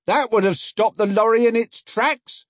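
high, loud put-on voice; man at the back. Man from the back of the crowd agreeing, high and loud. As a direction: call it out from the back.

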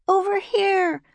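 put-on very high girlish holler; calling out. A narrator's reading. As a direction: call him over in a high child's voice. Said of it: "called Annie".

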